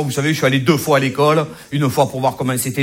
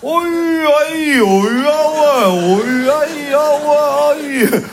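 put-on slurred, much higher drunk babble of only vowels; drunk vowel language; 9 semitones higher, much slower than usual. Drunk man after too much pastis babbling only vowels, high and slurred. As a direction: mumble tipsy vowels, high and stretched out. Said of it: "une langue où il y a que des voyelles".

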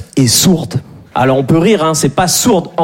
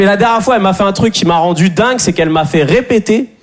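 ordinary delivery

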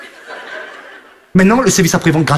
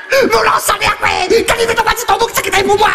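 much higher, yelling wife; angry wife yelling; 11 semitones higher than usual. Acted. Furious wife yelling at her husband over the goat. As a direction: scream at him like a furious wife.

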